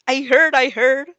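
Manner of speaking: loud eager reply. Acted. Dark Shadow's quick answer.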